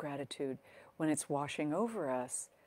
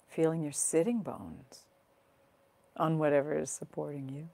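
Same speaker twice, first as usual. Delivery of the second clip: guided meditation. Acted. Teacher settles listeners into grounding exercise, calm guided meditation. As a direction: guide them gently into their body, phrase by phrase.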